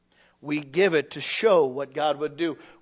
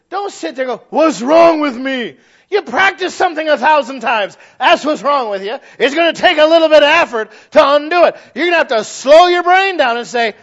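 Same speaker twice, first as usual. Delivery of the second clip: loud, high sermon climax; preaching at full force; 8 semitones higher, 12 dB louder than usual. Acted. Preacher scolding the congregation, loud and fervent. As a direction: drive the point home, full volume.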